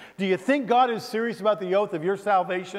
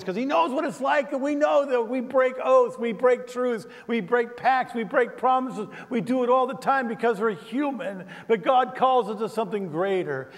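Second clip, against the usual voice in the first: higher, building preacher's cadence; preaching; 6 semitones higher than usual. Acted. Preacher driving home a point to the congregation, rising. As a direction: hammer each we break like a sermon refrain.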